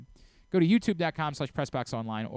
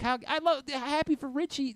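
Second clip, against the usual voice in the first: high mock-happy squeal; sarcastic cheer; 12 semitones higher than usual. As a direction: fake delight, pitched way up. Mock joy over rival's game.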